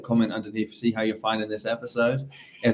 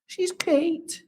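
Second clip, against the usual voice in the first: Mrs Doubtfire voice. Retold or imitated. Comforting the co-host as Mrs Doubtfire, high falsetto. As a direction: coo like a Scottish nanny. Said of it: "She's Scottish".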